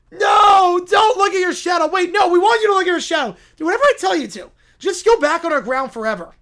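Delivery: put-on high, loud, panicky child's orders; bossy yelling kid. Kids ordering the groundhog about its shadow, bossy yelling. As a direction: yell contradictory orders like a frantic kid.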